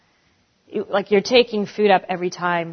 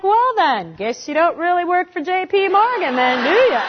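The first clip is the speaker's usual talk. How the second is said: sarcastic jab; 8 semitones higher than usual. Retold or imitated. Sarcastic comeback to the banker.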